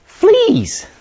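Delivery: sudden high cry of disgust; shocked high cry of fleas; cried out. A narrator's reading. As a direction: yelp it out, high and loud. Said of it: "she cried".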